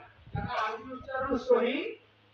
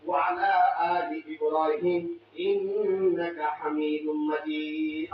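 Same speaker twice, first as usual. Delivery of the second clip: slow, low devotional chant; slow Arabic salawat chant; durood recitation; 7 semitones lower, 4 dB louder, slower than usual. Acted. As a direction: draw out each phrase reverently.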